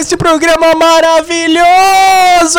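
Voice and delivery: high, loud shouted greeting; hype show opening. Acted. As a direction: yell it like a game show host. Opening a fake Tokyo show.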